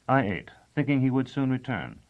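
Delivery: ordinary delivery, nothing marked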